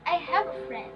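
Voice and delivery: "friend" falls away at the end; falling intonation. Shown as a demonstration. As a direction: say it as a statement, "friend" going down. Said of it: "We use falling intonation".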